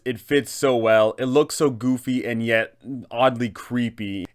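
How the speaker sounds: normal talking voice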